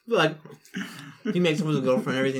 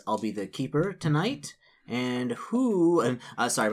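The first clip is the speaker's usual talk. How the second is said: normal talking voice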